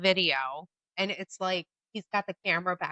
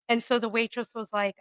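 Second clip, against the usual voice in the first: usual speaking voice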